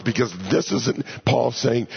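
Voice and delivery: ordinary talk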